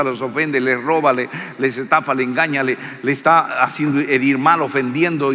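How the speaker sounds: ordinary talk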